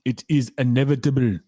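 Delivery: hammed-up Arnold-style Austrian accent on a film quote. Streamer quotes a film line to chat in a bad Arnold Schwarzenegger accent. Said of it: "Excuse the accent again".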